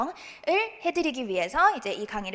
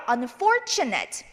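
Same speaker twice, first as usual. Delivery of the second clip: slow model "Unfortunate", leaning on FOR; stress on FOR; much slower than usual. Shown as a demonstration. Teacher modelling for the class to repeat, slow, stressed FOR. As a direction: draw out "Unfortunate" with a strong FOR. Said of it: "한번 읽어볼게요".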